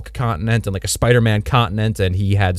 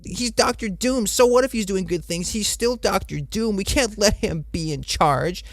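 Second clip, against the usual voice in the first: mocking whiny heroes; 11 semitones higher than usual. Mocking Iron Man and Sue Storm whining about Doom. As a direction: whine it up high like a sneering crowd. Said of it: "They're like".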